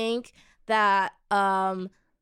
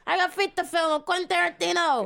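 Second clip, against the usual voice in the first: higher, laughing Tarantino voice; Tarantino impression; 7 semitones higher than usual. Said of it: "bad at impressions".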